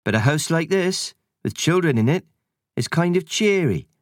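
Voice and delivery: lightly voiced cheeky country sprite; a hint of a rustic sprite. Sprite explains loneliness.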